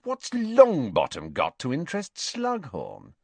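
high-pitched, incredulous question; scornful disbelief. A narrator's reading. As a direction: swoop up high in disdain. Malfoy scornful about Longbottom.

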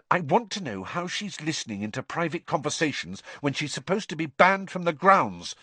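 angry. A narrator's reading. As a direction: demand it with rising anger. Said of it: "said Hermione angrily".

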